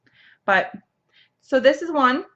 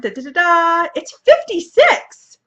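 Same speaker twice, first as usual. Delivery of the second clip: high, loud and slow reveal; drumroll announcement; 8 semitones higher, 7 dB louder than usual. Real, not acted. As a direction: sing a drumroll, then announce. Drumroll for the prize drawing.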